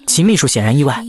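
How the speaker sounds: ordinary delivery, nothing marked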